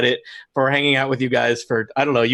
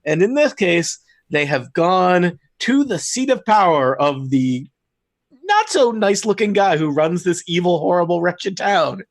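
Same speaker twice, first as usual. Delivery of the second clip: game master narration; 3 semitones higher than usual. Acted. GM scene setting.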